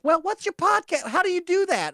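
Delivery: high, eager mock voice; pestering diner. Diners asking how to find the podcast, high pestering voice. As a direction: pipe up nosily in a put-on high voice.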